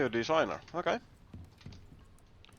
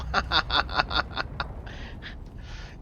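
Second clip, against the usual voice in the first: breathless laugh; 3 semitones higher, 4 dB louder than usual. Real, not acted. Laughing after the blast.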